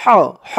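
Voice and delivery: big ha from the throat; throaty h sound. Shown as a demonstration. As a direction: breathe হ from the throat like Arabic ح. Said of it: "এখানে উচ্চারণ করতে হবে".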